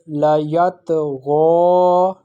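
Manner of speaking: "la yatgha" very slowly, ghain from top of throat; slow teaching demo of "la yatgha" with heavy ghain; thick ghain. Shown as a demonstration. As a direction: model the "gha" in "la yatgha" thick and drawn out. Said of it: "pronounced from the top part of the throat".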